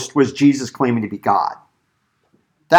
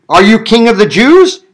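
loud, raised interrogation with rising end; questioning; 7 semitones higher, 5 dB louder than usual. A narrator's reading. Governor questioning Jesus before him, pointed questioning. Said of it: "The governor questioned".

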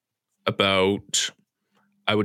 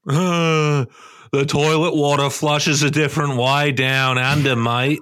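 hammed-up Aussie accent, pitched up; Australian accent; 4 semitones higher than usual. Co-host parodying toilet water down under, mock Australian accent. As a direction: put on a broad Australian accent. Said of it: "really good Australian accent".

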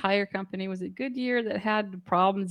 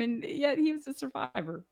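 ordinary talk

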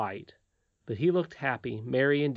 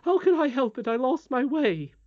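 flustered nurse; 12 semitones higher, 6 dB louder than usual. Acted. Nurse defending herself.

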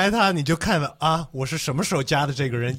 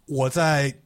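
ordinary talk

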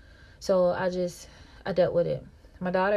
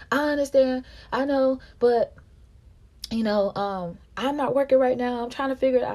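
quoting him; 6 semitones higher, 6 dB louder than usual. Retold or imitated. His voice, retold argument.

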